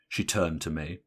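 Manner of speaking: ordinary delivery, nothing marked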